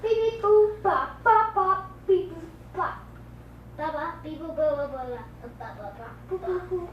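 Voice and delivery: loud chanted beep bop gibberish; fake foreign language. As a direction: bounce out nonsense syllables loudly. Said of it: "does she pay with Legos".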